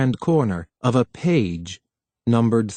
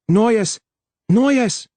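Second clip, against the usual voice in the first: high, loud calling shout; shouting a name; 10 semitones higher, 4 dB louder than usual. A narrator's reading. Harlan shouting for Noyes through the corridors. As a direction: call her across the empty halls. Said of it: "and raised his voice".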